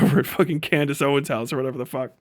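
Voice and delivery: ordinary talk, nothing marked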